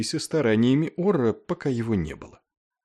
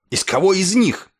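high, loud, incredulous challenge; raised, high-pitched retort; outraged question; 8 semitones higher, 5 dB louder than usual. A narrator's reading. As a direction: throw the question back heatedly. Clevinger's outraged question back.

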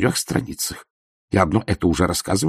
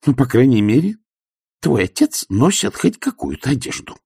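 pointed reproach, a little louder; reproachful mother; 6 semitones higher, 4 dB louder than usual. A narrator's reading. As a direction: chide the son by comparison. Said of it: "с упрёком сказала она".

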